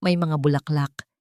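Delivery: ordinary delivery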